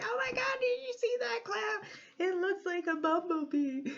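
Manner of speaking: high-pitched gushing squeal, easing down toward the end; giddy, high-pitched exclamation; excited tourist. Retold or imitated. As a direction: squeal in amazement at a sight, very high. Excitedly imitates herself spotting clouds.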